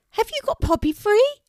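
very high, loud bird voice; questioning raven. Acted. Erin asks Kelty about the rescue, high calling voice. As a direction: call the question up, squeaky.

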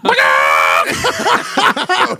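loud helpless cackle; howling laugh. Real, not acted. Bursting laugh at story.

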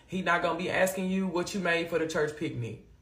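usual speaking voice

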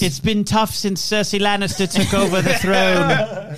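hammed-up British hardship plea; mock British accent. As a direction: plead in put-on British. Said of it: "she's putting on an accent".

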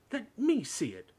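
high, loud, drawn-out demand; slow, whining-loud insistence. A narrator's reading. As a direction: insist like a spoilt child. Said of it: "demanded Dudley".

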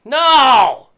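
high, loud, drawn-out no; playful shouted refusal. Pretend. Co-host told to hit the break button, mock-defiant shout.

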